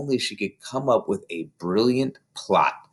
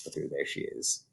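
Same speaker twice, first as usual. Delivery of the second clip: whisper; 7 semitones lower, 15 dB quieter than usual. Real, not acted. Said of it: "reader whispers aside to child".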